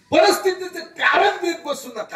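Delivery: high, loud preaching cry; rousing exhortation. Real, not acted. Preacher urging the crowd never to make excuses, loud rousing cry. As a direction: lift the crowd, full force.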